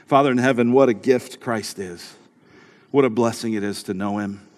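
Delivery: prayer. Acted. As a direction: lead the church in prayer. Leads prayer.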